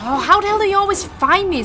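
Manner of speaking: high, loud, fast frustrated shout; real angry panic; exasperated yell. Real, not acted. Enemy finds him again.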